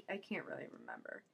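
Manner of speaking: whispered, rushed admission; sheepish whisper; whispered aside. Real, not acted. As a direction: trail off into a whisper. Trailing off, forgetting.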